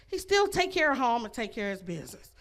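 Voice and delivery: ordinary talk, nothing marked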